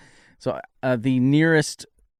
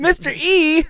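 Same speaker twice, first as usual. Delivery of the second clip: high, loud cry of a name; excited shouted greeting; calling out; 9 dB louder, slower than usual. Acted. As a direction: hail someone across a battle. Character calls out to Mister E.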